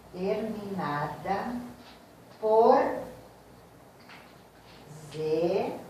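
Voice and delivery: very slow, drawn-out words with long gaps; slow dictation. Shown as a demonstration. Teacher dictating an accent rule to students, slow dictation. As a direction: spell the rule out word by word.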